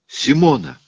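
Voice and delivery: high, loud cry of a name; shouting. A narrator's reading. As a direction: scream for her in alarm. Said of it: "закричал Рауль". (громкий отчаянный крик)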